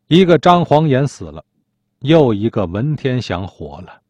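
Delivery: usual speaking voice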